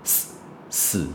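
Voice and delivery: "死，死" as a voiceless hiss at the tongue tip; just breathe the S of "死" twice, no voice. Shown as a demonstration. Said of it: "的差别就是". ("死，死"只用舌尖送气嘶声，不出声)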